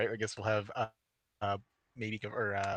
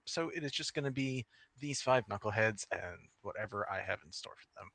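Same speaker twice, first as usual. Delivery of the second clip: ordinary talk, nothing marked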